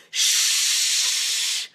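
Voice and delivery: long loud shh, straight into the ear; loud shush right into the ear. Shown as a demonstration. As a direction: shush like an angry librarian. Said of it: "really loud, shushing, angry librarian type sound".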